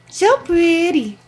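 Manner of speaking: high, slow cooing. Real, not acted. Cooing at the goose.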